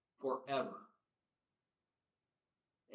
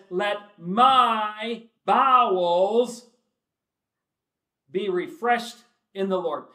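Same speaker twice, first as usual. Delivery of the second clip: slow, drawn-out, louder scripture reading; preaching at full force; 6 semitones higher, 13 dB louder, much slower than usual. Acted. Preacher pleading Paul's words to the congregation, drawn out. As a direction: stretch out every word of Paul's plea. Quoting the verse again.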